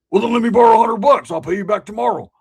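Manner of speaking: loud, high demanding yell; hammed-up pushy friend shouting; mock shout. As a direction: bark the demand at him. Retold friend demanding money.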